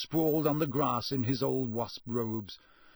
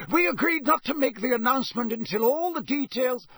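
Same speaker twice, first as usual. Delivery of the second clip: high, louder officious protest; testy official, pitched up; stern objection; 11 semitones higher, 5 dB louder than usual. Acted. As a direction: cut in to stop a leak. Crouch objects to Bagman's hinting.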